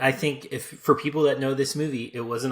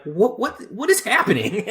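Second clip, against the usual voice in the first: bewildered; 10 semitones higher, 6 dB louder than usual. Retold or imitated. Baffled reaction to the movie.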